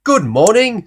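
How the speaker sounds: bright loud cheerful greeting; cheery Mister Happy. Acted. Mister Happy greets Mister Grumpy cheerily, loud and bright. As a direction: call out a sunny good morning. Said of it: "said Mister Happy".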